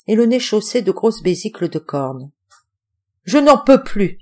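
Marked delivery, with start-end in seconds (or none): 3.2-4.2 louder, higher cry of exhaustion; exasperated cry. A narrator's reading. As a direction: cry out, at the end of his rope. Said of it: "s'écria-t-il de nouveau". (cri excédé, plus fort et plus aigu)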